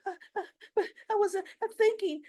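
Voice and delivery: put-on high, rushed, fumbling teenage excuse; mock stammering teen. Mocking Lucy as she fumbles an excuse, high and rushed. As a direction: mimic a flustered teen scrambling for words.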